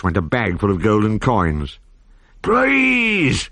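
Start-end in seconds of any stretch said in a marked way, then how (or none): 2.4-3.5 much higher, pleading; high desperate plea; begging. A narrator's reading. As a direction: beg for mercy. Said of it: "the pirate captain begged".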